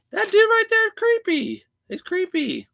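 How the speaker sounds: high-pitched joking voice; silly high voice, teasing; mock creeped-out. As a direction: pitch it way up in playful mock accusation. Joking retort to a viewer's "creepy.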